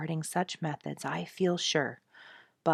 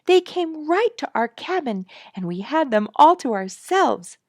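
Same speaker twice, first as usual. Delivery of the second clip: put-on excited child; 9 semitones higher, 8 dB louder than usual. A narrator's reading. Child continues excited news.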